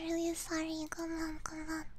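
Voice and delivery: usual speaking voice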